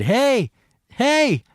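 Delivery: high-pitched hail to someone leaving; calling out. Retold or imitated. Calling after her in parking lot.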